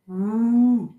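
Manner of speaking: loud mock howl; playful wolf howl. Teacher imitates wolf howl.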